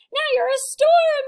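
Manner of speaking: high, loud dramatic cry; cried out. A narrator's reading. Teacher urging the kids into the storm dance, high and loud. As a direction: whip up the storm with your voice. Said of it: "the teacher cried".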